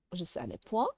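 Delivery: ordinary talk, nothing marked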